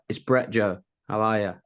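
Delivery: ordinary talk, nothing marked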